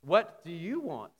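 gentler question. Shown as a demonstration. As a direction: ask it slowly, at an everyday volume. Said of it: "Or if he said like this".

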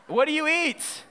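ordinary delivery

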